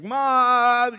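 a high, loud call of Mom. Retold or imitated. Sick daughter calling for her mother, a high loud call. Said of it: "She's like".

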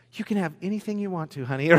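doting dad. Retold or imitated. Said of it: "you're just like".

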